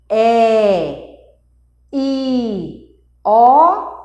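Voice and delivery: "É", "I", "O" each held out as a separate pure vowel; vogais sustentadas. Shown as a demonstration. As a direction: let the air flow through "É, I, O", one by one. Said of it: "Ele não encontra obstáculo pra essa passagem de ar". ("É, I, O" prolongados, um de cada vez)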